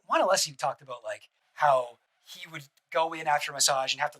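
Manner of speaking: ordinary delivery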